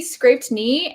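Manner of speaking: ordinary delivery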